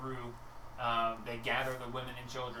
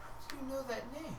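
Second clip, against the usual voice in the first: high, soft, tearful question; a mother stunned to hear her child's name; tearful shock; 11 semitones higher, 5 dB quieter than usual. Acted. Weeping mother asking the stranger how they know the name. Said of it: "you see a tear run down her face".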